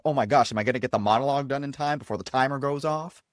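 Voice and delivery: fast, rushed fretting; mock stressed. Actor worrying about the time limit, rushed mock stress. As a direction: race through it like a worried actor. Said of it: "instead of stressing about".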